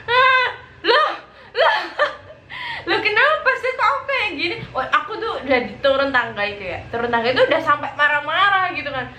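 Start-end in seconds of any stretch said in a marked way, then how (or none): 0.0-4.6 high, laughing cries of disbelief; shocked laughing. Retold or imitated. Retold shock when camera appears.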